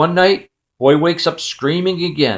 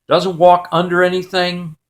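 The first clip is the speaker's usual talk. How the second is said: ordinary talk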